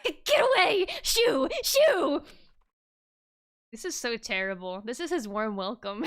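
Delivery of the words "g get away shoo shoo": shooing him away. Acted. Voicing friends shooing Makoto.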